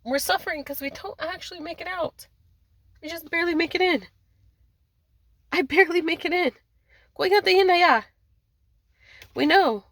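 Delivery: high-pitched, anguished channelled voice; acted plaintive outcry, pitched well up; distressed lament. Channelled voices lamenting their suffering.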